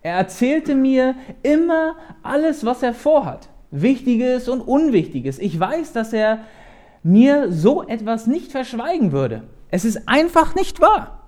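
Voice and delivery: protesting. A narrator's reading. Jonathan defends his father to David, protesting. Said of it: "protestierte Jonathan".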